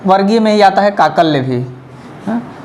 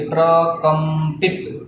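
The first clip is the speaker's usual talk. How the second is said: प्रकंपित stretched out, every syllable spaced; slow dictation; 3 semitones lower, 3 dB quieter, much slower than usual. Shown as a demonstration. Teacher repeating the term for the class, very slow. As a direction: drag out प्रकंपित like a dictation word. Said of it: "लुंठित भी बोलेंगे या प्रकंपित भी बोलेंगे".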